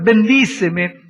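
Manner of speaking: "Bendíceme" stretched slowly; "Bendíceme" pulled out long and pleading; slow, heavy "Bendíceme"; drawn-out plea. Acted. First of two bendíceme.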